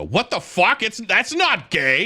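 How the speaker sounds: high, loud, indignant squawk; a mock-outraged friend, up high and loud; outraged buddy. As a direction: imitate a buddy who is offended. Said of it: "his knee-jerk reaction is immediately to go".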